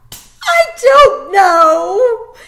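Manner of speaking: very slow, high, loud sobbing cry; choked crying. Acted. Girl crying at the soldier, choked. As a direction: wail it through tears. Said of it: "her voice choked".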